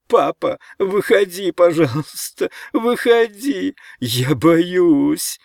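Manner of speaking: high, loud begging wail; panicked little-boy cry; crying out scared. A narrator's reading. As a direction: plead like a terrified small child. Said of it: "закричал Ричард".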